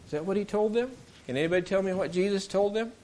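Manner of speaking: ordinary delivery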